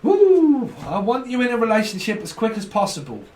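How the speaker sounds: high, loud whoop falling into keen speech; whoop, then blurt it as an eager lover; eager partner. Acted. Voices an eager would-be partner.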